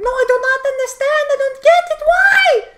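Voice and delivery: high, loud exasperated shriek; frustrated yelling. Retold or imitated. Phone clerk yelling at the confused guest, shrieking. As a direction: scream it out in total confusion. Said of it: "she was yelling".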